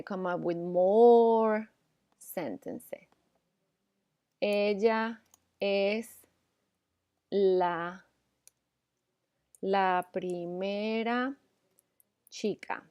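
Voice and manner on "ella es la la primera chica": la primera chica spoken slowly, word by word; slow dictation. Shown as a demonstration. Teacher dictating an example sentence, slow and careful. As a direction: read it out slowly so students can copy it. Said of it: "dictating example sentence slowly".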